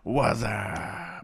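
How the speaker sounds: long drawn-out, mock "What's Up" holler; beer-ad catchphrase. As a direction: stretch the "Up" like the beer ad. Imitates beer commercial catchphrase.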